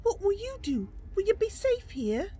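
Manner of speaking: Mercy voice. Acted. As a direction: voice the little Bosmer who wants to be a knight. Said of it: "I am voicing this character".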